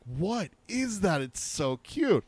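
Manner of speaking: put-on high, slow cooing little-girl voice; mock daughter gushing over something cute; imitating young daughter. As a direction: coo it like an excited ten-year-old. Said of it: "and she's like".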